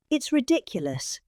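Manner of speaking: usual speaking voice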